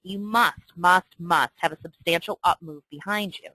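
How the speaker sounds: must, must repeated slowly and firmly; slow insistent must, must, must; emphasis on must. Shown as a demonstration. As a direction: lean hard on each must. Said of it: "but I'm emphasizing it".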